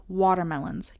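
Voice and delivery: ordinary talk, nothing marked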